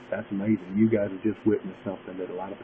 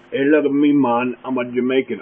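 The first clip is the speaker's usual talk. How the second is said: loud, raised Jamaican-accent imitation; exaggerated Jamaican voice, higher and louder; mock Jamaican accent; 5 semitones higher, 9 dB louder than usual. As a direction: announce yourself in a hammed-up Jamaican accent. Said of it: "she had some Jamaican kinda African".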